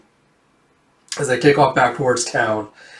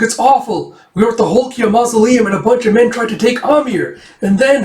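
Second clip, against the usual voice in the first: high, agitated mock panic; panicked report; 8 semitones higher, 4 dB louder than usual. Explaining to father what happened, panicked. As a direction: blurt out bad news in a high voice.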